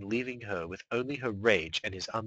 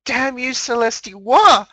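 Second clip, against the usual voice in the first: high, loud final wail; anguished scream; 16 dB louder than usual. Acted. Mare screaming at her sister as she wakes, loud and high.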